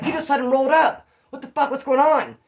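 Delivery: loud, high, incredulous demand; worked-up mock outrage; agitated retold accusation. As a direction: imitate someone demanding an explanation. Retelling an accusing, agitated man.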